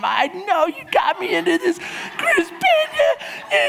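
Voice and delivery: high, laughing mock whine; imitating whiny wife. Mocking his wife's complaint on the climb, high mock whine. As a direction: ham up a complaining wife, stretching the name. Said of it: "Melissa's complaining the whole time".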